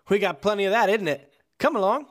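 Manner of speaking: a cheerful British dockhand, mildly put on; British accent. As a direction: manager offers help in light British accent. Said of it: "No, it's British".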